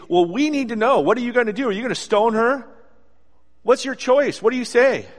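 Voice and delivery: high, loud, pressing questions from the crowd; badgering him to answer, voice up and loud; pushy accusers. Pharisees hounding Jesus, demanding he rule on the woman, pushy and loud.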